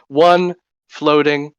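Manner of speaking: ordinary talk, nothing marked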